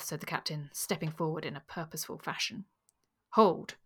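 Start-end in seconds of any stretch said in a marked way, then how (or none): 3.3-3.8 loud, higher-pitched command; a ringing, self-important "halt" from a pompous character; portentous command. A narrator's reading. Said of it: "in such throbbing and portentous accents".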